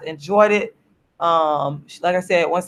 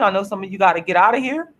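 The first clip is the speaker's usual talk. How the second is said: ordinary talk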